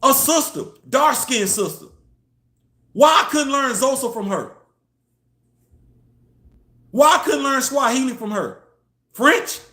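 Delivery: loud and high, building to disbelief; rising outrage peaking on French; incredulous rant. Real, not acted. Ranting about his French teacher.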